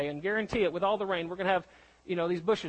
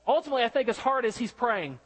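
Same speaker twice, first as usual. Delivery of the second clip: normal talking voice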